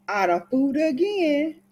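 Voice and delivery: high, rising singsong on "again"; singsong little victory crow; sly gloating. Acted. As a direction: lift "again" up high in a teasing boast. Gloating over fooling the grandmother.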